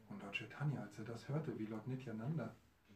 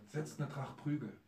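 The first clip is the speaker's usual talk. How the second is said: ordinary talk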